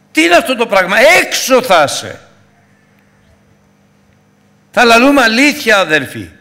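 high, loud rebuke from the pulpit; fiery preaching. Acted. Preacher scolds the congregation about lying, loud fiery preaching. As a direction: thunder the warning at them.

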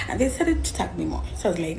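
usual speaking voice

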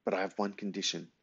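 put-on soft, serious old lady; a hint of a stern grandmother; elderly voice. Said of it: "deadly serious look".

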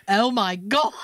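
mock-British exclamation; hammed-up British dismay; horrible British accent. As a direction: exclaim as a bad British impersonation. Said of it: "I do a horrible British accent".

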